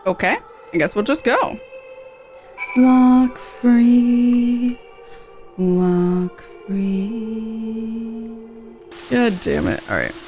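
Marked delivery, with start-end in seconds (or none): none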